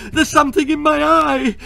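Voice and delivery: high, loud, desperate scream; high panicked shrieks. Acted. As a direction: beg for help in pain. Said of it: "screamed Wen".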